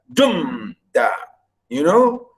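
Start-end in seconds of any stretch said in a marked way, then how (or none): 0.1-1.1 "dumda" stretched on "dum", then a short low "da"; stress on first beat. Shown as a demonstration. Teacher explaining stress at the first, "dumda" front-stressed. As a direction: draw out "dum", pause, drop "da". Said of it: "Stress at the first".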